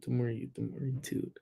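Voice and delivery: put-on a shy kid embarrassed to say "toot"; timid mumble. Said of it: "you gotta say it louder".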